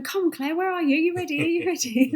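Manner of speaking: mock-impatient crowd calling for someone; eager chivvying. Retells followers urging her to tweet.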